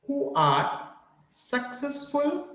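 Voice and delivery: "who are successful" pitched high, "successful" stretched slowly; high drawn-out dictation. Shown as a demonstration. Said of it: "मैं बहुत धीमे बोल रहा हूँ".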